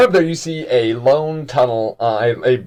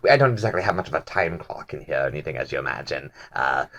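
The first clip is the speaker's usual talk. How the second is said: low, quick, fumbling excuse; Ort's voice; 9 semitones lower, 5 dB quieter than usual. Acted. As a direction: mumble the excuse fast and low. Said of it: "he goes".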